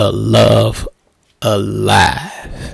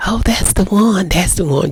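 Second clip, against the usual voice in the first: much higher, delighted praise from relatives; admiring folks; 8 semitones higher than usual. Retold or imitated. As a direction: gush like an impressed aunt. Folks admiring the new girlfriend.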